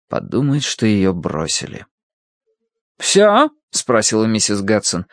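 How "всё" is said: high, loud one-word challenge; testy Bible reader. Acted. Woman done reading aloud to patient, testy high demand. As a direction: ask it sharply, pitched far up. Mrs. Gadson asks testily.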